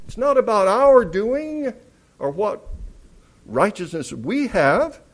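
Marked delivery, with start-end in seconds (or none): none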